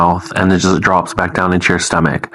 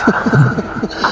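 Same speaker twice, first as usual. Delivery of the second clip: high-pitched ghostly giggle; imitated hysterical laugh; 11 semitones higher than usual. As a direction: laugh like the haggard woman. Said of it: "she's laughing".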